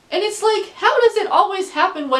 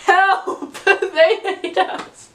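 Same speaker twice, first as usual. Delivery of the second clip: higher, laughing mock plea, slowed at the end; mock cry for help; 7 semitones higher, 4 dB louder, much slower than usual. Student laughing at school pizza, mock despair.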